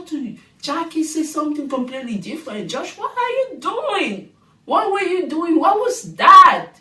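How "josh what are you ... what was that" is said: high, loud disbelieving cry; incredulous. Real, not acted. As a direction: shout it in disbelief at the TV. Reacting to Josh on TV.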